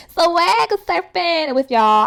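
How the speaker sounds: high, slow, stretched-out words; playful drawn-out exclamation. Real, not acted. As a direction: stretch it out playfully after fumbling it. Playfully redoes the phrase after laughing.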